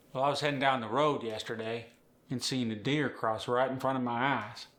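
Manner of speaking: put-on unhurried Appalachian accent; Earl. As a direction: let it breathe like an Appalachian storyteller. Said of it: "Notice Earl didn't rush".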